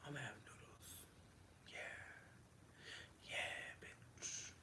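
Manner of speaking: fast whispered self-talk. Real, not acted. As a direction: whisper it to yourself. Muttering to herself.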